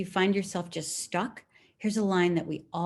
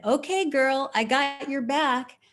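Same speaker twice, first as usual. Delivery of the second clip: daughter's voice; 7 semitones higher, 4 dB louder, slower than usual. Retold or imitated. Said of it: "the way she said".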